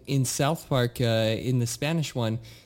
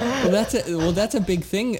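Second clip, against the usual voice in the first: laughing; 7 semitones higher than usual. Real, not acted. Laughing while answering.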